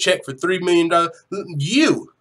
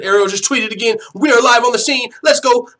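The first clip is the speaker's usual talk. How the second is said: higher, louder breaking-news hype; excited live announcement; 5 semitones higher, 6 dB louder than usual. Retold or imitated. Fan relaying a breaking tweet to viewers, excited live announcement. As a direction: announce it like you just went live.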